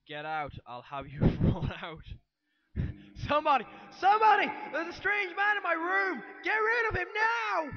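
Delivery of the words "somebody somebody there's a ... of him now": high, loud panicked calls for help; old mother shrieking for staff, high and loud; frantic shouting. Acted. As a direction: scream for help at the intruder. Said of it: "I felt I needed to shout that bit".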